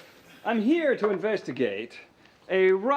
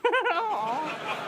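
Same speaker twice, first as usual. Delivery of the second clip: hearty high burst of laughter; 11 semitones higher than usual. Acted. Detective laughs.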